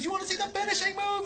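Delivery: high, held-back teasing call; crowd-rousing wrestler. Retold or imitated.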